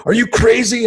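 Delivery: very high, panicked outcry; high-pitched role-played alarm; mock panic. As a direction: act out a dad suddenly panicking. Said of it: "so that I panicked".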